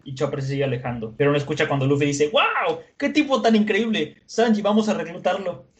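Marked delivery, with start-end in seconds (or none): 2.3-5.7 high-pitched, thrilled exclamation; imitated boyish excitement; acted amazement. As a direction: burst out with eager wonder. Acts out thrilled Luffy.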